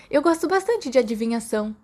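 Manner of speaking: usual speaking voice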